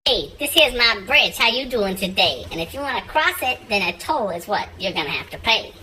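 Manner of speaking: low bridge keeper voice. Acted. Bridge keeper demanding a toll from the rabbit. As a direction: growl it low like the bridge guard.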